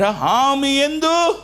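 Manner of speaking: ఆమె యందు stretched very slowly and high; emphatic repeat. Shown as a demonstration. Pastor dwelling on the verse, slow and high.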